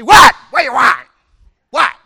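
sharp, high, loud snapping What; grumpy snapping. Acted. Wife snapping at husband after a bad day, shouting. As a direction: bark back at him like a cranky wife.

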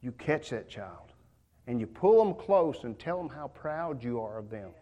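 ordinary talk, nothing marked

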